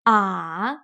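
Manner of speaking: A dipping low then rising; A dropping down then back up; third tone. Shown as a demonstration. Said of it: "A few more times".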